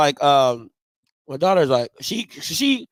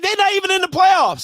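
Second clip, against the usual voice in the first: high-pitched mock outrage; indignant protest; 12 semitones higher than usual. Dad protesting daughter's team choice, mock indignant. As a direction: argue back in exasperated high voice.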